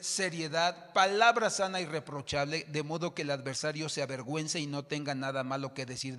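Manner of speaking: full-force sermon reading; preaching scripture. Acted. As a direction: proclaim it to the church.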